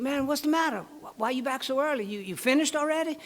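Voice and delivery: high-pitched, acted bewilderment; puzzled buddy. Joke character puzzled by friend's return.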